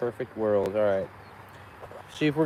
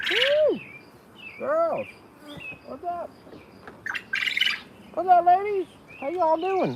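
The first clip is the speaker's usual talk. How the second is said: cheery greeting to bees. Real, not acted. Beekeeper hails his bees, excited and high-pitched.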